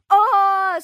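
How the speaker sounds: long, high, very loud yell; bear cub's holler. Acted. Bear cub yelling as he somersaults, long high holler. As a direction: holler one long open cry. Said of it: "снова завопил медвежонок".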